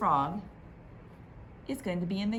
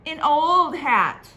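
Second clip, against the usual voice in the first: mocking old hat; 9 semitones higher, 12 dB louder, slower than usual. Others mock Harbert.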